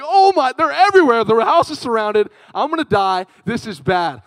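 high, loud mock panic easing downward; hammed-up cry of a terrified kid; retold panicked screaming. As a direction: scream it out, then sink into doom. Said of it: "I start screaming".

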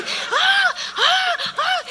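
high, hammed-up screams of a frightened woman; mock panicked scream. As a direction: scream like she's terrified in the water.

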